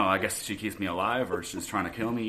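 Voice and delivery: normal talking voice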